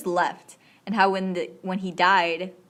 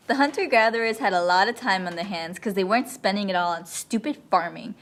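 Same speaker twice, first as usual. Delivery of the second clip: normal talking voice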